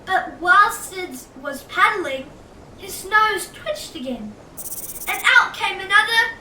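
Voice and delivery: usual speaking voice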